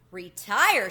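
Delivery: loud, shocked one-word cry; shrieked. A narrator's reading. Tucker Mouse hearing Chester will retire, loud shocked shriek. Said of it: "shrieked Tucker".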